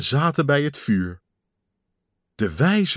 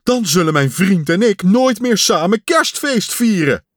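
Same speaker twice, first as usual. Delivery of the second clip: higher and louder, near wailing; despairing cry; 8 semitones higher, 6 dB louder than usual. Acted. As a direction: cry out at the thought of losing him. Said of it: "riep Pat". (wanhopig uitroepend)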